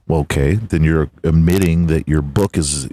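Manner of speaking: ordinary talk, nothing marked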